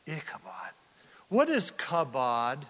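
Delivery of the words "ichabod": hushed reveal. Shown as a demonstration. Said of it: "Pause for theatrical, um, emphasis".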